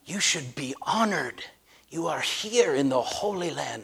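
a guide hushing a tour group in reverence; mock reverent tour guide. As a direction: lay the holiness on thick, hushed. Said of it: "laying on this thick religious thing".

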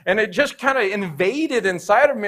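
normal talking voice

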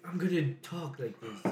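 soft mock presidential voice; a quiet, lightly put-on politician; Donald Trump. Friend wondering why he talks that way, soft impression. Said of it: "What made him start talking like that?".

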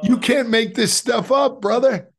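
high, loud amazed exclamation; excited exclaim. Real, not acted. Telling his host the miracle story, a loud amazed exclamation. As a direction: burst out in amazement.

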